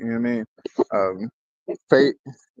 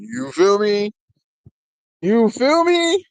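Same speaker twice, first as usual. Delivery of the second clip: high catchphrase climbing to a loud, stretched squeal; playful hype; 9 semitones higher, 4 dB louder, slower than usual. Pretend. Host jokes he's already barring, playful rising hype. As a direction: push it higher and louder, dragging out the repeat. Hyping up his own bar.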